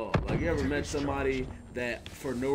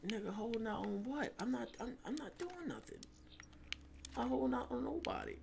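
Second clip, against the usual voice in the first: higher, softer indignant denial; mock defensive reply; 11 semitones higher, 9 dB quieter than usual. Friend denies holding out, in a mock higher voice. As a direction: play the wrongly accused friend.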